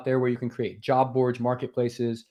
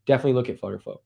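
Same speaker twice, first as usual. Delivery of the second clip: ordinary talk, nothing marked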